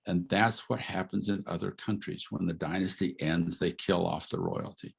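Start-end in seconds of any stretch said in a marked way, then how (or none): none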